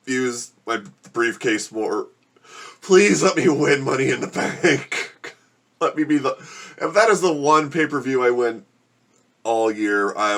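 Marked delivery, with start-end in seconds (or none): 2.8-7.2 desperate plea. Real, not acted. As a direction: plead for the win as if in real pain. Begging while burning from spice.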